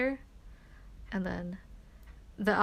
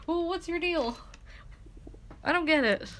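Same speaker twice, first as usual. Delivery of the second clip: exasperated; 8 semitones higher than usual. Retold or imitated. Retold confrontation with friend.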